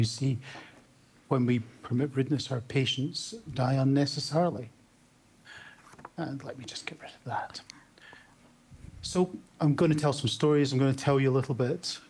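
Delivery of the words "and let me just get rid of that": a real whispered aside to himself. Real, not acted. Presenter fixing his shared screen, whispering to himself. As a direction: breathe it under your voice while fiddling with the screen.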